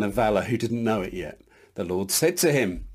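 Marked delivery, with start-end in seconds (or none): none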